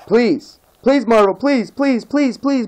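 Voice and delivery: ordinary talk, nothing marked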